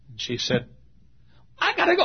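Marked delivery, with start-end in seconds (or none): 1.6-2.0 hurried lady. Retold or imitated. Pastor voices the hurried lady.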